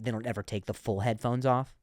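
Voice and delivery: usual speaking voice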